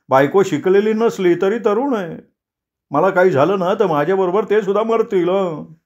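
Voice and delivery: high, fretful clerk pleading; scared family man, voice pushed up; Deshpande panicking. Acted. (उंच, घाबरलेला देशपांडे)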